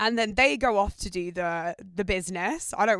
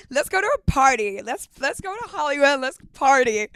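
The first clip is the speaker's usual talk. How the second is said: put-on higher, louder, bubbly party-girl voice; mock American party girl; 5 semitones higher, 7 dB louder than usual. Mocking American girls from TikTok, in a high, loud party-girl voice. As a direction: send up a gushing American girl. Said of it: "takes the piss out of American girls".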